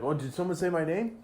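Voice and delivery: high, quick little voice piping up; lightly voiced eager youngster; eager Pinecone. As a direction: pipe up eagerly, pitched high.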